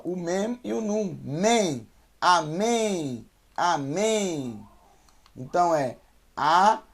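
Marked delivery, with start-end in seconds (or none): 1.2-4.6 amém built up from Mem, stress on the final mém; Hebrew syllable drill. Shown as a demonstration. Teacher building amém from its letters, clearly. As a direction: spell it out: Mem, then amém. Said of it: "aqui eu tenho mais uma sílaba".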